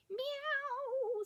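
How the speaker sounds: long, high wailing meow; cat meow. A narrator's reading. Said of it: "said Wilbur".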